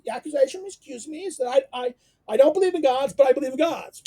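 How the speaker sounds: a high mocking retelling of the charge; mocking Socrates. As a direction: poke fun at the accusation. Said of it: "Socrates is mocking".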